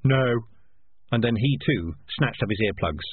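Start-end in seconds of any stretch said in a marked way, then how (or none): 0.0-0.4 contained shout; shouted warning. Acted. In Brine shouting into a drain, contained shout. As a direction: pass the shout along, held back. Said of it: "In Brine shouted into a drain".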